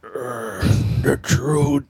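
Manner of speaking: low, slow, ominous reveal; dramatic deep voice. Acted. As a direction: drop low and pause between words. Dramatic pronouncement, long pauses.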